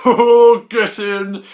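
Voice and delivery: high, loud burst of delight; thrilled at a fifteen-quid bargain, yelled high and loud; excited. Real, not acted. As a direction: cheer like you got a bargain. Delighted at the cheap price.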